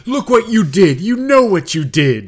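hammed-up blaming shout; mock accusation. Mocking the guys' accusation.

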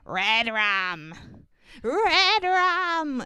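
put-on eerie child's chant, the second one high; rising, spooky redrum chant; creepy Tony voice. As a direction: croak it out like a possessed kid. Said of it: "in that creepy fucking Tony voice".

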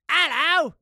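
high, loud attempt at Irish; bad Irish accent. Greeting Ireland's listeners, badly faked Irish accent. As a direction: botch a hearty Irish greeting. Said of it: "I can't even do an Irish accent".

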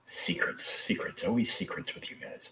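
hushed teasing whisper. Real, not acted. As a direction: whisper it like a secret. Teasing about secret cover.

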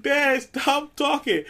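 high, drawn-out plea of an embarrassed son; mortified son. Retold or imitated. Embarrassed son to his boastful dad, mock pleading.

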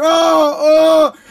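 loud hammed-up pained Oh, oh; mock pained cry. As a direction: fake an injury cry loudly.

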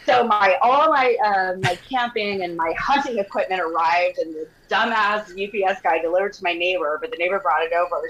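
ordinary talk

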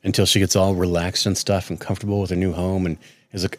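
ordinary delivery

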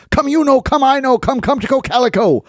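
high singsong dog call. Acted. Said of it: "He tried calling for his dogs".

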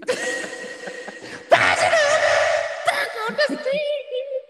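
high shrieking cackle; shrieking laugh. Real, not acted. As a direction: scream with laughter. Explosive laugh at friend's reaction.

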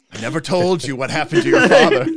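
laughing through the line; cracking up mid-quote. Real, not acted. Laughing Star Wars parody line.

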